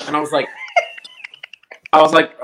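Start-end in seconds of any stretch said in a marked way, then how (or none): 0.5-1.9 high squealing laugh. Real, not acted. Disbelief at the vague callback.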